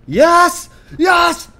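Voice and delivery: high, loud cheers of victory; explosive, high-pitched celebration; jubilant yell. Real, not acted. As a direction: shout it in real triumph. Celebrates a successful jump.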